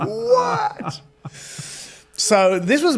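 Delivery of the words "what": very high, squeaky "what"; hammed-up incredulous squeal; mock astonishment. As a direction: react in comic disbelief, voice leaping up.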